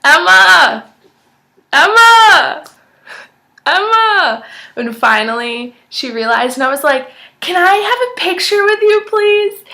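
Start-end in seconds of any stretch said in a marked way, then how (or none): 0.1-4.4 high, loud yells to get her attention; shouting after a celebrity over the crowd noise; screaming a name. Retold or imitated. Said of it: "I just started screaming". 7.4-9.6 excited high plea. Retold or imitated. Fan begs celebrity for photo.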